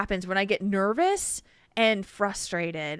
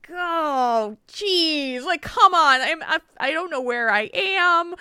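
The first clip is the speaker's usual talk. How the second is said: higher, louder, fed-up whine of a lost driver; exasperated groan; 8 semitones higher, 5 dB louder, slower than usual. Retold or imitated. Lost driver to herself, exasperated and pitched up. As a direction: let the frustration spill out, pitched up. Frustrated at getting pulled over.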